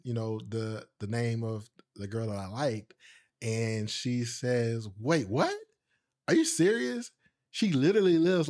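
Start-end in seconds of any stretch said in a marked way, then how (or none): 5.0-8.5 high-pitched disbelief, imitating the cousin; astonished cousin. Cousin learning her neighbor is the crush, astonished. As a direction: react to startling news with rising pitch.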